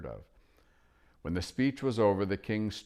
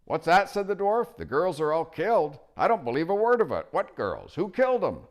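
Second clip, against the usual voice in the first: put-on high, loud cranky old dwarf; deaf old dwarf; 12 semitones higher, 9 dB louder than usual. Dwarf cupping his ear at the owl, loud and cranky. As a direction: bark it like a deaf old man.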